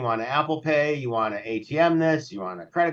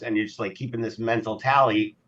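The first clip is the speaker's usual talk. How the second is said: usual speaking voice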